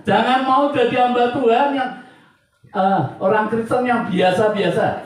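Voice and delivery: high, loud pulpit proclamation; impassioned preaching. Real, not acted. Preacher vows to serve God wholeheartedly, preaching with full force. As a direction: declare it forcefully to the congregation.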